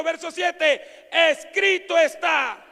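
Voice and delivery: loud, fervent shouted preaching; full-voiced revival preaching; preaching at full force. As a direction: cry it out to the congregation.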